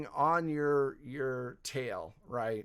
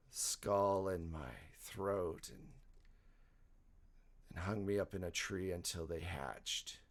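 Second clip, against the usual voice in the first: a soft, creepy demon-possessed voice; low monster voice; 7 semitones lower, 8 dB quieter than usual. Acted.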